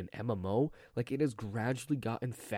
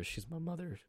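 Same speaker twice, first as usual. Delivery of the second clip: voice crack; 6 semitones higher, 8 dB quieter than usual. Real, not acted. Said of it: "Ooh, voice crack".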